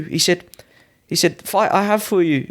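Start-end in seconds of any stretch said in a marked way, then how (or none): 1.4-2.5 high-pitched imitation of the promoter; Thai promoter. Promoter announcing a fight to the fighter, high imitated voice. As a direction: imitate him, pitch raised. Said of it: "the promoter come up to me and went".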